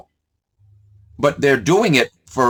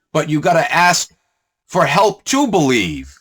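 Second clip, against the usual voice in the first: ordinary delivery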